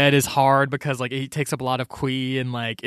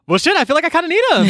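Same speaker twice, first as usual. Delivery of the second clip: much higher, louder, fast panicked blurt; frantic realization; 12 semitones higher, 5 dB louder, faster than usual. Retold or imitated. Leland trapped in the dungeon, high and fast in panic.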